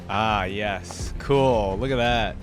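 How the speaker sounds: normal talking voice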